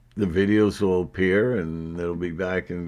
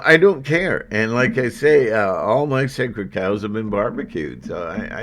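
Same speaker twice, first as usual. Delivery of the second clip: ordinary talk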